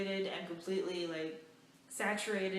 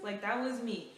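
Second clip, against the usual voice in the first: usual speaking voice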